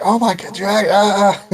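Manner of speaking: high-pitched crowd panic, breaking into a loud cry; hammed-up terror at a monster; mock panic.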